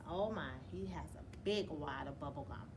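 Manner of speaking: ordinary delivery, nothing marked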